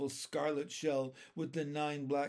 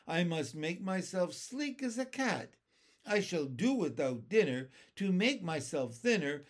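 higher, bouncy rhythmic rhyme; comic verse recital; 5 semitones higher, 4 dB louder than usual. A narrator's reading. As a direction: recite a mocking rhyme with relish. Centipede's song recited as verse.

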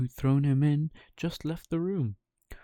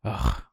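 cricket groan; 10 semitones lower, 3 dB louder than usual. Acted. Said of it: "said the cricket".

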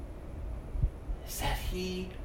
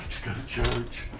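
careless shrug; 3 semitones lower, 6 dB quieter, faster than usual. Retold or imitated. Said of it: "if it's like".

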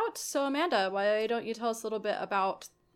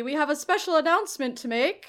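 town crier; 4 semitones higher, 7 dB louder, faster than usual. Acted. Town crier announcement continues.

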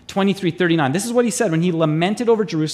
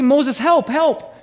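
mock plea for help; 6 semitones higher, 3 dB louder, much slower than usual. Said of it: "And so they say".

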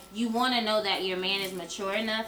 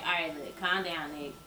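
calming a fight; 5 semitones lower, 7 dB quieter than usual. Retold or imitated. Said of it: "the ability to be like".

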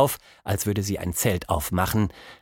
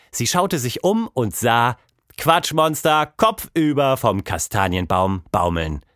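ordinary delivery

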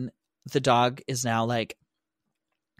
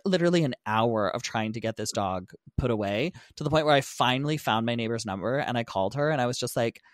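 normal talking voice

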